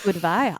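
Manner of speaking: "Goodbye" with a v for the b; playful mispronunciation. Pretend. Co-host joining the joke, "Goodbye" as "good-vye".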